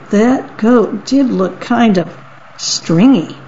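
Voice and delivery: ordinary delivery, nothing marked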